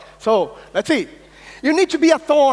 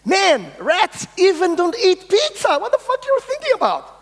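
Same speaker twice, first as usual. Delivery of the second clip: high, scornful squeak of a doubting rat; mock the dreamer like a sneering rat; scoffing rat voice; 7 semitones higher than usual. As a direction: pitch shooting up on the rude question. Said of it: "other ones saying".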